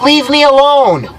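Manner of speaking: high, drawn-out fed-up plea; retold exasperated demand. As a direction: act out being at the end of your rope. Retold final demand to be left alone.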